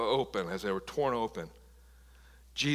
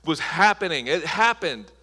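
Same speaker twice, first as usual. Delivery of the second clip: full-force preaching; 6 semitones higher, 7 dB louder than usual. Acted. Pastor preaching to the congregation at full force. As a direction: preach it out to the church.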